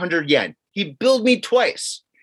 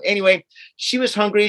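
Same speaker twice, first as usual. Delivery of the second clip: usual speaking voice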